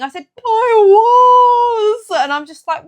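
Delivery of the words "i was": long, high, loud mock wail; wailing sob. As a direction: bawl it out in hammed-up tears. Acts out crying that she was laughing.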